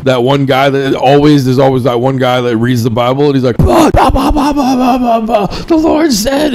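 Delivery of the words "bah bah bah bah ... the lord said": mock angry prophet. Imitating the guy who reads the Bible, mock angry rant. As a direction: bark out gibberish like an angry Bible reader. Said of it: "the angriest fucking prophets in the world".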